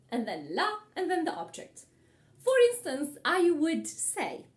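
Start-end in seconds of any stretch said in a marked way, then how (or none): none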